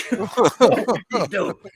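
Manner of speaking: slow, put-on copy of the line; slow mock villain voice. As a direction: echo the impression slowly. Repeating the impression.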